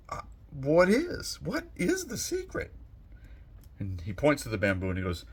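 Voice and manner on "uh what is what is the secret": high, rising pleading question; a student begging to know. Acted. Student begs master for secret.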